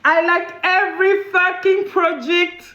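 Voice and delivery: slow, hammed-up broken English with a French accent. Shown as a demonstration. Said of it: "mon anglais, il sort". (anglais cassé à l'accent français)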